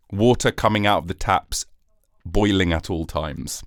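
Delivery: ordinary talk, nothing marked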